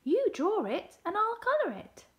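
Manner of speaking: high, loud, eager plan; excited. A narrator's reading. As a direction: propose it with bubbling excitement. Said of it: "She was excited too".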